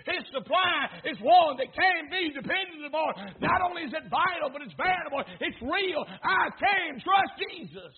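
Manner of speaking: loud, rising pulpit proclamation; building to a fervent shout; fervent preaching. Real, not acted. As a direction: shout the promise with conviction. Preaching at full force.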